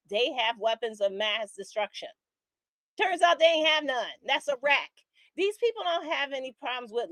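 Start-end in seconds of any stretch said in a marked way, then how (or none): 3.0-4.1 higher, louder sarcastic payoff; mocking punchline. Real, not acted. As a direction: land it as a scornful punchline. Punchline of rant.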